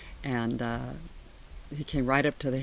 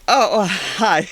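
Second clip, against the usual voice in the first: loud flustered hello; 5 semitones higher, 12 dB louder, slower than usual. Retold or imitated. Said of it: "how loud it went".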